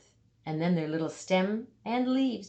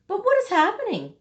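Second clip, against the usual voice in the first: much higher and louder, sudden alarm; alarmed question; 13 semitones higher, 6 dB louder, faster than usual. A narrator's reading. Storyteller alarmed for the little plant, high and loud. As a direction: gasp out the question as danger looms. Narrator alarmed as danger nears.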